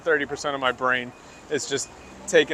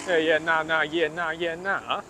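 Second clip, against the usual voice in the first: Australian accent; 3 semitones higher than usual. Shown as a demonstration. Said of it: "that accent is awful".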